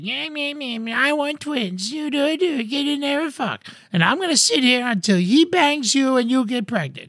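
very high nagging caricature voice; mad mother-in-law. Retold or imitated. Mother-in-law demands twins from the couple, nagging. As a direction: nag in a hammed-up, high-pitched mom voice. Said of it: "his mother's just mad".

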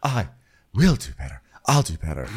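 understated mock promise, with pauses; Sheree. Mimics Sheree's restrained version of it.

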